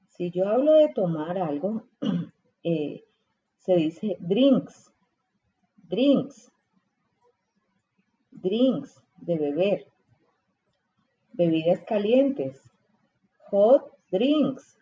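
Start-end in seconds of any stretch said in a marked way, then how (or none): none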